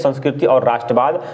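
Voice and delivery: normal talking voice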